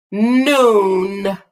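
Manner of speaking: Noon with the N held long at both ends; stretched N sounds. Shown as a demonstration. Teacher modeling beginning and ending sounds, N held. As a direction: draw out the N in Noon, slow and deliberate. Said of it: "beginning and ending of noon".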